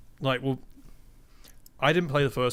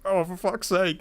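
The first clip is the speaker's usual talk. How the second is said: exasperated; 8 semitones higher, slower than usual. Retold or imitated. Player frustrated by the overcomplicated puzzle.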